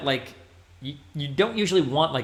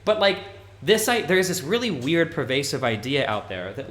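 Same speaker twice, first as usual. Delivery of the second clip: normal talking voice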